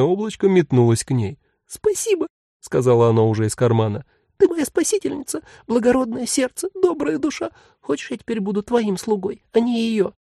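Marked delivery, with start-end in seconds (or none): 1.7-10.1 grateful tiny voice. Acted. Tiny demon thanks her.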